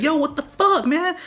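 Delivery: very high mock female voice; imitated sister's outcry. Sister coming out to the fight, high imitated outcry. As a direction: mimic her alarmed protest up high.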